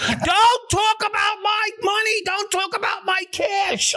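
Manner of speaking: high, slow shouted protest; Kenny shouting to keep his freezer cash out of it; retold outburst. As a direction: defend your money at the top of your voice. Kenny's retorts acted out.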